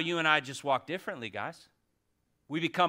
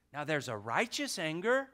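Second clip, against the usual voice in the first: ordinary talk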